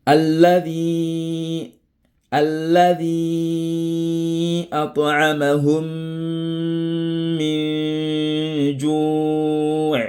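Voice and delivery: slow, drawn-out chanted recitation; Quran recitation. Shown as a demonstration. Teacher reciting a Quran example to students, slow and chanted. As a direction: recite each word slowly, like tajwid chanting. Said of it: "voici un peu le premier exemple".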